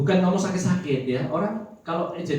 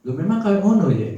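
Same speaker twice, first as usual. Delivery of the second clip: sister's reply; 5 semitones higher, 9 dB louder than usual. Retold or imitated. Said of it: "gitu kan jawabannya".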